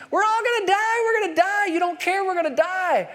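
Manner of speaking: high, frantic cry that they'll all die; panicked scream. Retold or imitated. Disciples waking Jesus in the storm, high panicked screaming. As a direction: shriek it like terrified sailors in a storm. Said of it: "they wake Jesus up screaming".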